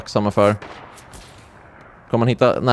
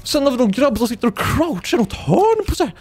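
baffled; 13 semitones higher than usual. Real, not acted. Baffled by odd enemy positions.

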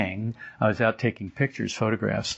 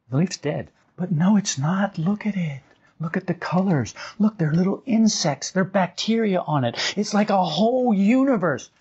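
excited wonder; 8 semitones higher than usual. Acted. Marvelling at a dead leaf.

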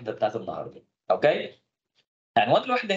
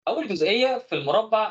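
normal talking voice

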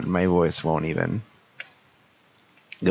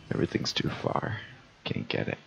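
unvoiced whisper straining for a note; 7 dB quieter than usual. Real, not acted. Whispering when the high voice will not come. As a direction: whisper it, reaching for a voice. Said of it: "Maybe if I just whisper".

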